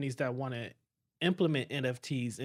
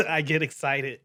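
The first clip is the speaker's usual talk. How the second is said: laughing; 7 dB louder than usual. Real, not acted. Laughs about loving suits.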